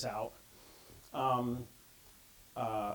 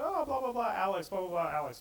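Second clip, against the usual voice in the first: put-on high-pitched gushing teen chatter, falling as it goes; a mother mimicking her daughter's chatter about Alex; mock teen daughter; 5 semitones higher than usual. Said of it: "she's like".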